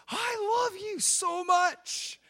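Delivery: high, loud, drawn-out gush of love; gushing to unborn baby. Retold or imitated. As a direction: pour out delighted love to a baby in the belly. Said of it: "I would literally say things like this".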